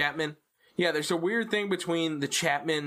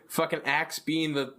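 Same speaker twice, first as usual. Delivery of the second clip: ordinary talk, nothing marked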